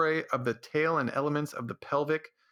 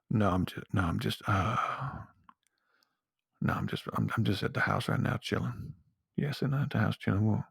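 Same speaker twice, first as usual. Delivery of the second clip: deep, smooth phone voice; low register drop; 4 semitones lower than usual. Retold or imitated. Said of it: "make your voice sound deep and sensual".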